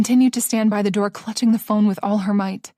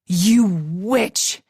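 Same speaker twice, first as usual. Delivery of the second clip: accusing. Acted. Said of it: "he accused".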